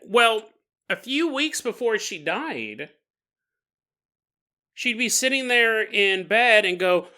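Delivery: ordinary delivery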